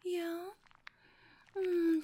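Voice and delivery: ordinary talk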